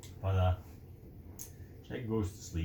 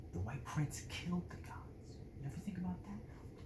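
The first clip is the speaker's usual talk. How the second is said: soft, secretive whisper; guard whispering his theory; hushed suspicion; 4 semitones higher, 11 dB quieter than usual. Acted. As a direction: murmur it like a dangerous secret.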